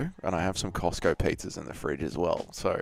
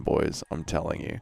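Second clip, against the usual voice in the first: ordinary talk, nothing marked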